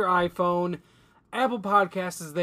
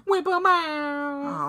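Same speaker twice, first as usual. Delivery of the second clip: drawn-out siren; 8 semitones higher, much slower than usual. Pretend. Siren bit continues, drawn out.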